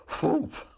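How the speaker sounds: sudden loud, high "foomph" imitating a blast; explosion noise. Son telling how the prank cigarette blew up, imitated explosion. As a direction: burst out a mock puff of exploding cigarette. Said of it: "lit up the cigarette and went".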